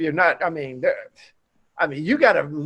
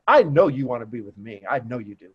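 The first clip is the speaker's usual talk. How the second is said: low, softening flirt; smooth flirt; 7 semitones lower than usual. Retold or imitated. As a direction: lean in and purr it low. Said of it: "I'm like".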